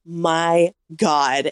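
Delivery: very slow, word by word; slowly spaced, dumbfounded reaction; stunned disbelief. Retold or imitated. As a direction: drag it out in retold shock.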